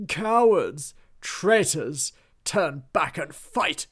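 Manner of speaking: loud, raging bellow at fleeing troops; furious shout. A narrator's reading. Duke yells at his deserting army, furious and loud. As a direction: scream them back to the fight.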